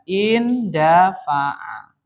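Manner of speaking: "indafa'a" drawn out syllable by syllable; stretched-out classroom example of "indafa'a"; slow model reading. Shown as a demonstration. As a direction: sound out "indafa'a" very slowly. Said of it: "yang lima huruf misalnya".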